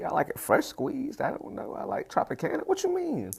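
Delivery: high, squeaky playing dumb; mock confused. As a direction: pretend you think it's orange juice, high-pitched. Plays dumb about O J.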